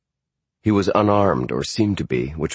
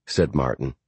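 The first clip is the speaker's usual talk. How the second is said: usual speaking voice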